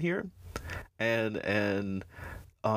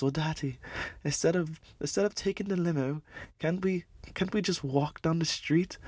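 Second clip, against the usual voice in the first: put-on higher, quicker whiny kid voice; a small boy begging his dad, pitched up; little son pleading; 7 semitones higher than usual. Vlogger voicing Bruce as a boy asking his dad to walk, higher and whiny. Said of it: "his son right there just looks at him and says".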